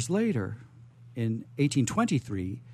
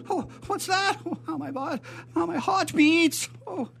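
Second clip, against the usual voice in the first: much higher, trembling-voiced frightened servant; nervous servant. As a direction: squeak it out like a jumpy servant. Plays nervous servant Fritz.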